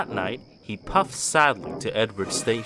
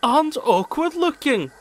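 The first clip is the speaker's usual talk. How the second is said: mocking; 11 semitones higher than usual. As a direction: rub it in. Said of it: "finished Bill".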